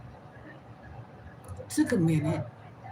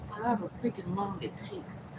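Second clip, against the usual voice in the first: normal talking voice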